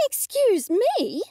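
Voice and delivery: high, slow outraged shriek. Acted. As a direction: shriek it, scandalised. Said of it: "I shrieked".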